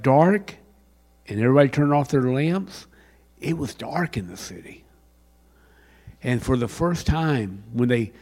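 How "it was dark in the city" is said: whisper. Acted.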